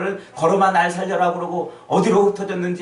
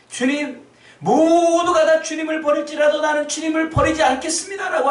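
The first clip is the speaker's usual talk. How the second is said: much higher, loud protesting cry; fervent Peter; 14 semitones higher, 7 dB louder than usual. Acted. Peter protesting to Jesus, high and loud. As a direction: swear loyalty with everything you have. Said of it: "절규를".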